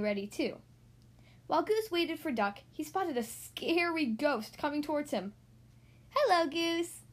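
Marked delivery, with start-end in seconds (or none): 6.1-7.0 higher, drawn-out spooky greeting; ghostly call. A narrator's reading. Ghost-costumed Duck calls to waiting Goose, spooky call. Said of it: "the voice called".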